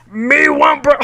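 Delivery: loud, high gruff monster voice; Cookie Monster voice. Pretend. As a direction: growl it like a hungry puppet. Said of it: "I said it in my Cookie Monster voice".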